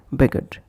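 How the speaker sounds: "begged" said as beg-ud; wrong extra syllable. Shown as a demonstration. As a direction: add a vowel before the d of "begged". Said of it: "and not".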